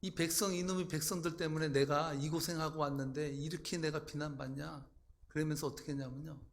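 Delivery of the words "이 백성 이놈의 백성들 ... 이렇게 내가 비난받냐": normal talking voice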